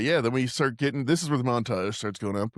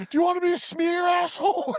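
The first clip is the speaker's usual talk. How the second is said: high, strained tough-guy yell; hammed-up high-pitched menace; mock furious threat. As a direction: act out a heated threat. Acted threat over downed man.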